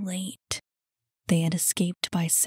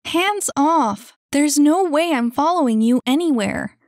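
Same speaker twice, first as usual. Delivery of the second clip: high, loud indignant protest; angry refusal; 7 semitones higher, 7 dB louder than usual. Acted. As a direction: refuse outright, voice raised. Shakes off kid's grip.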